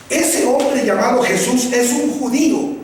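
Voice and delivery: ordinary talk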